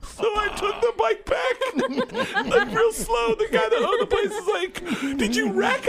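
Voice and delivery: high, laughing through the words; can barely get it out for laughing. Real, not acted.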